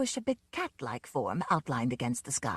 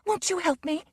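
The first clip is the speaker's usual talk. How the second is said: desperate plea; 11 semitones higher than usual. A narrator's reading. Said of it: "She appealed desperately to him".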